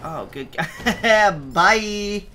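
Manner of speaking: laugh, then call out a farewell; amused laughing. Real, not acted. Player seeing something funny happen, laughing. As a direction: laugh breaking in before the last word. Enemy knocked away.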